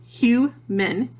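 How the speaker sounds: "human" slowly as hu, pause, man; split into syllables. Shown as a demonstration. Teacher showing both vowel sounds, "human" split in two. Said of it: "two syllables".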